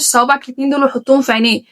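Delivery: ordinary talk, nothing marked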